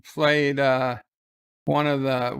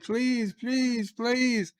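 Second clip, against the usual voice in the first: put-on much higher pleading little-girl please; childish begging; 8 semitones higher, 4 dB quieter, much slower than usual. [name] begging her distracted father, high childish pleading. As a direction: beg like a small child, pitched up high.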